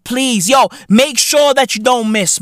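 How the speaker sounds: ordinary talk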